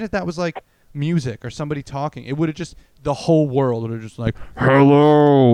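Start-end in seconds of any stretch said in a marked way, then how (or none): 4.6-5.5 loud, long-held hello; booming mock broadcast. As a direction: blast it like a voice from every phone. Imagines every phone blasting at once.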